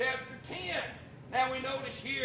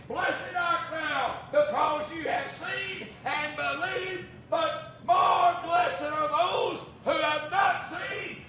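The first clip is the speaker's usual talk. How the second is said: loud, slow, higher proclamation; preached blessing; 5 semitones higher, 5 dB louder, slower than usual. Acted. The Lord proclaiming blessing to Thomas, loudly. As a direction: bless them with ringing force. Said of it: "The Lord said".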